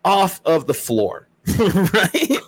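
ordinary delivery

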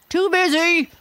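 high, loud, curt squeak of a rhinoceros. A narrator's reading. Rhinoceros brushing his tusk brushes off the nosy boar, high and loud. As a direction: toss it off high and loud, too busy to look up. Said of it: "said the rhinoceros".

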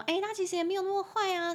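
high, quick mock-soothing friend voice; defending a friend. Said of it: "朋友C帮朋友B去说".

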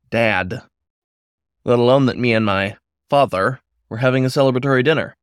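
plain reading voice

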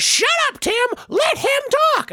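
Handy snapping. Acted. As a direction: bark it at Tim from the hand. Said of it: "My hand says".

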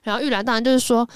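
usual speaking voice

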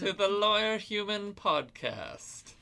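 slow, high, loud radio host; radio announcer voice. Pretend. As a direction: stretch it out like a station ident. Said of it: "That's the best radio voices we got".

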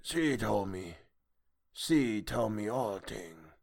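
soft, slow monster voice; hushed creature speech; sea creature. A narrator's reading. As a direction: answer quietly in an inhuman voice.

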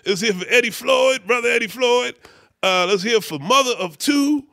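high-pitched imitated stage announcer; mock show roll call. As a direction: call out the performers like a bandleader. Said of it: "[name] is o-, on stage. He says".

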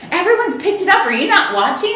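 high, loud, astonished; excited friend. Retold or imitated. Friend on the phone about the blog: high, loud, astonished. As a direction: squeal the news down the phone.

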